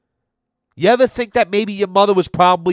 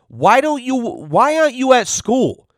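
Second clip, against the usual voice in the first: put-on higher, incredulous question to a little kid; 8 semitones higher than usual. Talking to the truant six-year-old, incredulous. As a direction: ask the kid in mock disbelief. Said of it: "I go".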